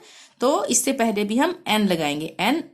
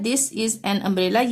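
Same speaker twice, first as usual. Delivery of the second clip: slow, even repeat of the sentence; slow dictation; much slower than usual. Shown as a demonstration. Teacher repeating the sentence for pupils, slow and clear. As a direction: spell it out slowly for learners.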